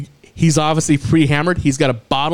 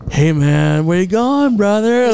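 mock drunk bro, pitched up; drunk buddy voice; 5 semitones higher, slower than usual. Retelling the drunk friend offering a ride, mock bro voice. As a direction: play the drunk friend all breezy.

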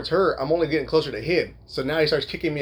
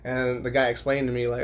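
ordinary talk

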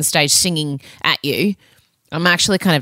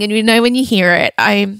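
ordinary talk

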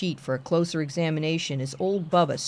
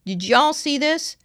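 steady sheriff drawl; 9 semitones higher, 3 dB louder than usual. Acted. Sheriff points out hand.

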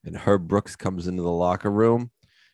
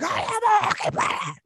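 very high, strained imitation yell; mock defiant yell. Hurt player answers coach, mock defiant yell. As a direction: yell it back in a put-on high voice.

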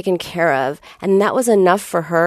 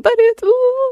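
much higher, whiny mock sob of a small girl; hammed-up child whimpering; mock child's crying. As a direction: imitate the kid crying over her knee. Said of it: "She keeps crying".

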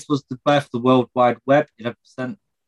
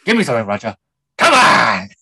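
overacting shout; 7 dB louder than usual. Quoting Pacino overacting.

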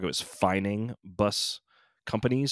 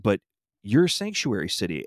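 ordinary delivery

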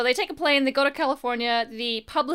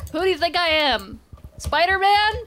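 louder, higher incredulous retort; mock indignant; 6 semitones higher, 5 dB louder than usual. Peter snapping at the lawyers, mock indignant and rising. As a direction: toss it back in mock disbelief. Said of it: "And he's like".

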